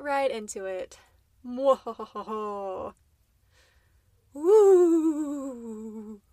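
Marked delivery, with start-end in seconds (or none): 1.5-2.9 slow evil cackle; mad scientist laugh. Pretend. Spooky Halloween episode evil laugh. 4.3-6.2 loud high whoop; excited whoop. Pretend. Spooky intro excited whoop.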